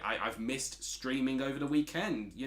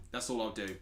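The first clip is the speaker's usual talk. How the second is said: ordinary talk, nothing marked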